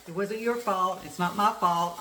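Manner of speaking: loud, emphatic retelling of the cashier; retelling the cashier's words to the viewers, loud and emphatic; retold cashier. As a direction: belt out the cashier's reassurance. Cashier quoted at the checkout.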